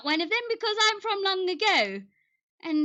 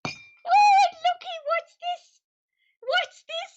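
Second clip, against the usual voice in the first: much higher, curious cooing; delighted surprise; 13 semitones higher, 4 dB louder, slower than usual. Acted. Curious guest finding something new, cooing in high surprise. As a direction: gasp of wonder at a new find.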